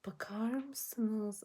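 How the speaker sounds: slow unvoiced whisper to viewers. Real, not acted. Vlogger shows her makeup to viewers, slow whisper. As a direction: draw them in close, hushed and slow.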